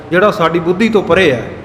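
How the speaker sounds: ordinary talk, nothing marked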